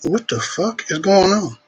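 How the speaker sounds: startled by gunshots outside, wondering aloud; alarmed disbelief. Real, not acted.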